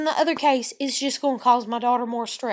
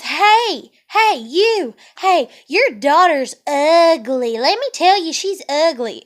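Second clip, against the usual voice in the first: louder mock playground taunt; taunting kid; 3 semitones higher, 5 dB louder, slower than usual. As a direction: call out across the yard like a mean kid. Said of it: "this kid that knows my daughter says".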